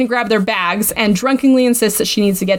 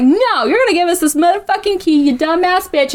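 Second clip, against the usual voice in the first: a higher, sassy telling-off; sassy rant; 6 semitones higher than usual. Retold or imitated. Drunk Hart demanding the key, high sassy rant. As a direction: mouth off at her with sass. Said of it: "A little sassy".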